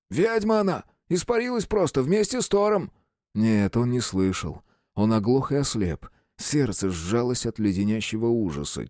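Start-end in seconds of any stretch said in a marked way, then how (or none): 0.1-2.9 much higher, frightened cry over the radio; the guard panics, voice jumping up; panicked high voice. Acted. Испуганный голос по рации. (испуганный высокий крик по рации)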